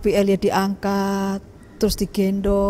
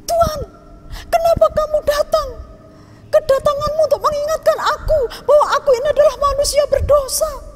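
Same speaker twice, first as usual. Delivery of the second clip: very high, loud, crying reproach, fast at first; angry grieving widow; 5 dB louder than usual. Acted. Widow cries out at the prophet after her son dies. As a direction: cry out your blame through tears. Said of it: "Dia marah".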